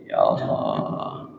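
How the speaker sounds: deep, slow, soft groan; low groaning sigh. Acted. Preacher groans at the pinch's pain. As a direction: sink it into a low moan.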